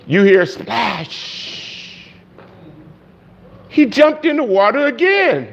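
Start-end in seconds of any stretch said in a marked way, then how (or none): 3.7-5.5 exasperated. A narrator's reading. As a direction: pitch jumps way up in disbelief. Exasperated narration of repeat jump.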